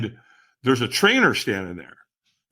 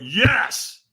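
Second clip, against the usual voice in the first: higher, more forceful yes; stronger yes; 6 semitones higher than usual. Acted. Answering the trainer's demand for more, stronger. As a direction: push the yes up with effort.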